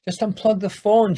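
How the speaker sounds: wife tersely telling husband to unplug phone. Acted. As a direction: snap it short at your husband. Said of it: "she says, somewhat tersely".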